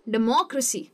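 "Democracy" with MO punched, falling end; deMOcracy, third-last syllable stressed. Shown as a demonstration. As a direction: hit MO in "democracy". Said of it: "मो जो है उसके ऊपर मैं ज़्यादा".

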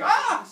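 high, loud mock scream; sudden hammed-up cry of alarm; startled yell. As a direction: yelp as if tripping. Acts out tripping with baby.

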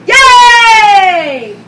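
shouted yay, full out; big excited whoop to open the show; loud cheer. Acted.

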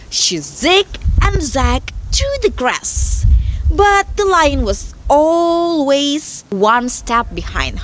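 slow, lilting storytelling voice; story narration. A narrator's reading. Telling the fable of the lion and the mouse, storyteller voice. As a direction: tell it unhurriedly, drawing out key words.

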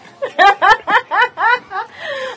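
long, high peals of laughter; hearty laugh. Real, not acted. Host teases the farmer about his life, hearty laugh. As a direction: burst out in a rolling high laugh.

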